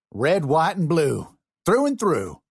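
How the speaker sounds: high, loud Southern boast; proud declaration. A narrator's reading. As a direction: puff up and proclaim it with pride. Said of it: "proudly declared".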